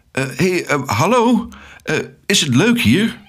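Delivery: high, tentative hello; hesitant greeting. A narrator's reading. Alex greets strange cockatoos in the forest, hesitant and high. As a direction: call out unsurely, rising high.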